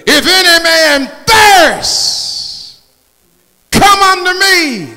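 high shouted gospel call; fiery preaching. Acted. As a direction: call the weary to come forward. Preacher calling out climax.

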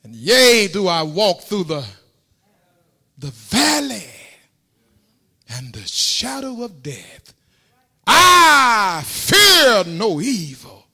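high, loud, drawn-out whooping sermon; full-force preaching. Acted. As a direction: preach the psalm to a shouting climax.

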